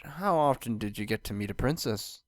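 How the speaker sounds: plain reading voice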